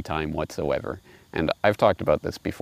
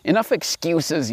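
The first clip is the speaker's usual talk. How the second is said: yelled; 7 semitones higher than usual. Acted. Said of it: "yelled the wolf".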